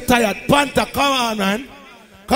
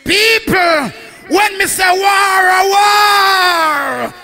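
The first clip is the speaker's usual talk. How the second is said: hype shout; 5 semitones higher than usual. Real, not acted. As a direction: whip up the crowd. MC hype call.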